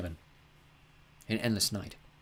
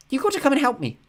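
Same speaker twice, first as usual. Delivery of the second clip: put-on higher young girl's voice, intent and a little louder; a girl sharing a vision, pitched up; Mina, earnest girl; 8 dB louder than usual. A narrator's reading. Girl describing baby birds' dreams, in a higher voice. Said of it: "she said".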